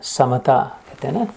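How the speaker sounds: ordinary delivery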